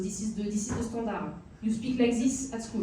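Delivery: thick French accent, "this" said as "zis". Shown as a demonstration. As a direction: put on a heavy French schoolroom accent, "this" becoming "dis". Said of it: "you can speak with the French accent like this".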